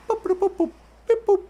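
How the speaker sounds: high robotic boop boop sounds; computer beeps. Pretend. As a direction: beep like a ship's computer.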